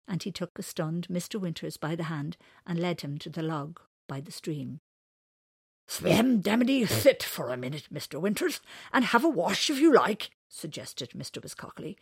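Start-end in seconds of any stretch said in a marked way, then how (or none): 5.9-10.3 loud, higher creature voice; animal character. Acted. Wiscockley suggests Winters sit and wash, loud creature voice. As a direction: call it out as a lively animal.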